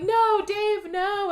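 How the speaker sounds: high, anguished cry over a body. Retold or imitated. Woman finding her friend dead, high anguished cry. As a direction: cry out in grief. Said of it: "she's all like".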